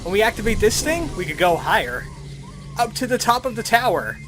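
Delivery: ordinary talk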